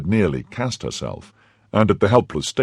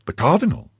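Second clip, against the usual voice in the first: surprised wife; 11 semitones higher than usual. Acted. Wife startled by husband's news.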